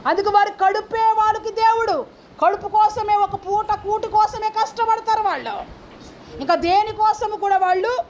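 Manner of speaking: high-pitched pulpit denunciation; forceful preaching. Real, not acted. Preacher denouncing those whose god is the belly, high forceful preaching. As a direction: denounce them in a high, driving voice.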